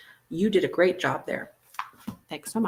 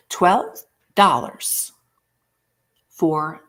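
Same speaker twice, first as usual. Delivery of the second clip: Twelve dollars drawn out slowly and louder, falling; dramatic price reveal; 5 dB louder, much slower than usual. Shown as a demonstration. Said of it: "you better just go, "Ee!" when I say this".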